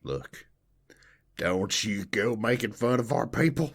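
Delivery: hammed-up Southern scold; Southern accent. Defending her Southern people, thick mock Southern accent. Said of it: "throw on the accent real thick".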